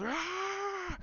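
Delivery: mock scream held back from full volume; imitated battle cry. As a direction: stretch a thin high mock yell. Imitates Palpatine's lightning cry.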